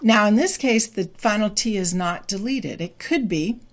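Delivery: normal talking voice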